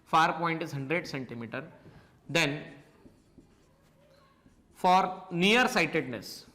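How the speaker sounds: slow, spaced-out dictation with long pauses. Acted. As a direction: read it out slowly so students can write every word. Dictating notes to the class.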